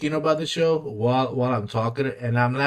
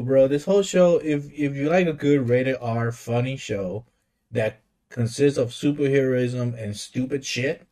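ordinary delivery

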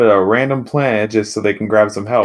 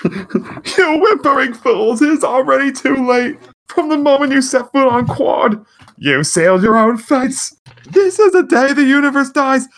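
Mister Popo. Acted. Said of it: "you should definitely do it as Mister Popo".

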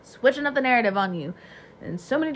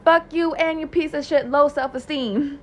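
mock yell; 7 semitones higher, 7 dB louder than usual. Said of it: "I'm just like".

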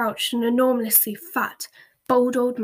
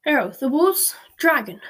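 louder call over the noise; raised cheery shout of Horace; 4 semitones higher, 5 dB louder than usual. A narrator's reading. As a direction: shout it across the clattering room. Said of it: "shouted the wizard called Horace".

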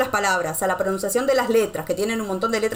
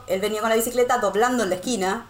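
usual speaking voice

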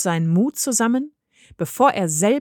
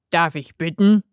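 lightly voiced showman wolf; a hint of a wolf, politely inviting; wolf character; 3 semitones lower, 4 dB louder than usual. A narrator's reading. The wolf invites Mirko into the wardrobe, lightly voiced.

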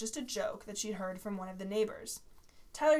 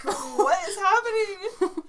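laughing, high and loud; 10 semitones higher, 9 dB louder, much slower than usual. Real, not acted. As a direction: crack up in disbelief.

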